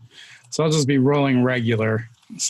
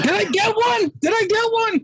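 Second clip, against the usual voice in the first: excited call. Real, not acted. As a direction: yelp it out, desperate to know. Player calling out over laughter.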